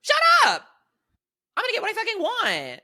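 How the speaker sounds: very high, hammed-up shriek of outrage; mock outraged yell. Acting out a fed-up diner at a restaurant, mock outrage. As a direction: explode in exaggerated high-pitched fury.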